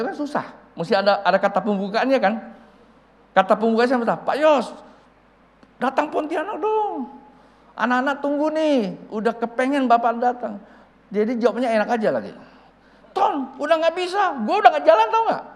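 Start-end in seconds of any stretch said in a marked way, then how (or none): 4.3-10.6 high, loud coaxing call; pleading invitation. Retold or imitated. Preacher begs the older pastor to visit Pontianak, pleading loudly. 13.2-15.4 very high, loud protest; exasperated refusal. Retold or imitated. Old pastor refuses Ton's invitation, exasperated and loud.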